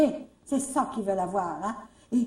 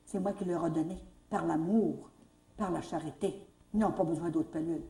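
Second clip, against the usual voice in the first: hushed sincere answer to the director; quiet firm reply; 5 semitones lower, 8 dB quieter than usual. Retold or imitated. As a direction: lower and softer, calm and certain.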